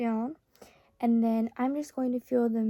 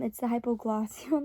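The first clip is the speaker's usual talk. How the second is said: ordinary delivery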